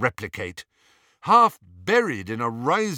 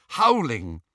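ordinary delivery

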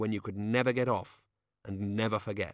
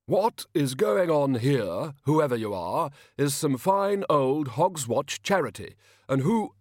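louder, slow, stately indignation; regal king; 5 semitones higher, 5 dB louder, slower than usual. A narrator's reading. King drawing himself up before an intruder, regally. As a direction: draw yourself up and proclaim it loudly. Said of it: "as regally as he could".

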